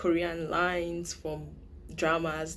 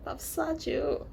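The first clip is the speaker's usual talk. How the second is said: high aegyo plea to oppa; cute girlfriend; 9 semitones higher, slower than usual. Retold or imitated. Girlfriend sweetly asking oppa to buy food. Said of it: "she'll be like".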